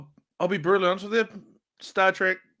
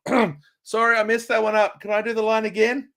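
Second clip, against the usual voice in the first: put-on higher, apologetic English accent breaking character; flustered English accent, a bit higher; bad English accent; 6 semitones higher, 3 dB louder than usual. Actor apologising to the crew for a fluffed line, in English accent. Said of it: "I'm gonna put on a really bad English accent".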